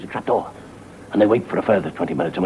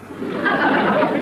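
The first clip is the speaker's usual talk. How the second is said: high breathless evil cackle; vampire cackle. Acted. Host cackling like a vampire as he swoops. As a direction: cackle like the Count about to bite. Vampire laugh in horror intro.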